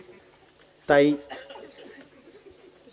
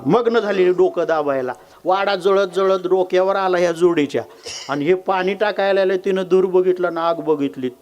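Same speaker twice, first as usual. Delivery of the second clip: normal talking voice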